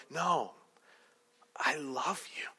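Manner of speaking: soft whispered declaration; intimate insistence. Acted. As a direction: whisper it as a plea to the spouse. Insisting again to spouse.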